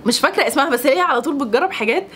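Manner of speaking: usual speaking voice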